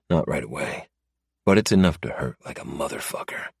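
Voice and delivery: plain reading voice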